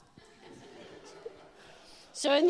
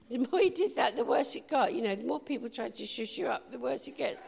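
usual speaking voice

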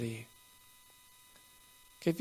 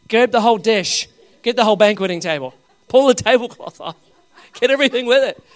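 loud, high preacher's exhortation; exuberant, punchy commands; rousing urging; 6 semitones higher, 8 dB louder than usual. Real, not acted. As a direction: egg the crowd on loudly. Urging congregation to take all.

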